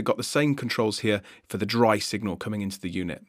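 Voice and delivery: ordinary talk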